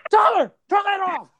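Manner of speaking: very high-pitched mock-furious order; outraged dad. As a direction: imitate a father frantically scolding his son. Acts out dad scolding young Tyler.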